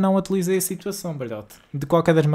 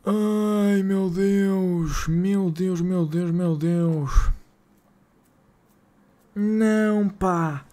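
higher, then slow dragged-out oh my God; despairing groan; 3 semitones higher, much slower than usual. Real, not acted. Streamer to chat as the transfer falls through, slow despairing groan. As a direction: let it sag into slow exasperated despair.

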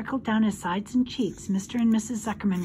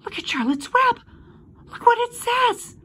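put-on high, excited girl's call; loud, high-pitched wonder; excited cry; 14 semitones higher, 5 dB louder than usual. A narrator's reading. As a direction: cry out in amazement. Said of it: "cried Fern".

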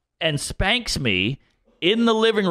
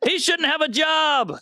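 higher, laughing mock-sweet voice; mock sweet critic; 9 semitones higher than usual. Mocking the cancel crowd, high and laughing. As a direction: sneer through a sugary act.

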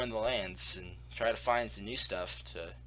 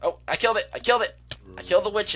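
excited shout; 9 semitones higher, 8 dB louder, faster than usual. Real, not acted. Just killed a witch.

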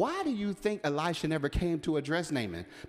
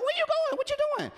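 much higher, frantic mock yell; squeaky voiced Balaam. Balaam shouting at his stopped donkey, high and frantic.

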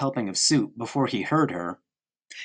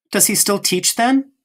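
bright, much higher question rising at the end; eager curiosity; 9 semitones higher, 3 dB louder than usual. Acted. Mother to Kurt about his father, eager and high. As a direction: perk up with real interest.

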